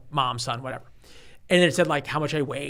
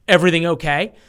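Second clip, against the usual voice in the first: a louder worried call, imitating the dad; anxious dad calling; 3 semitones higher, 8 dB louder than usual. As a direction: lean around the curtain and call out. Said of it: "It's like".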